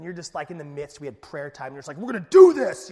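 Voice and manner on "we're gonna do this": hammed-up pep-talk shout, high and very loud; psyching yourself up. Preacher imitating someone fired up in prayer time, shouting. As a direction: shout it like a mock rallying cry. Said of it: "you're kinda psyching yourself up".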